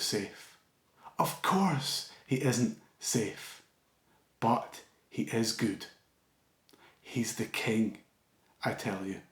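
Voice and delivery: slow, hushed read-aloud of a classic passage; story reading. Acted. Preacher reading the congregation a Narnia passage, slow and hushed.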